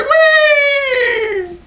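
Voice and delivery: high, squealing joyride cry; gleeful whee. Retold or imitated. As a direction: shout it like on a rollercoaster. Escaping in a vehicle.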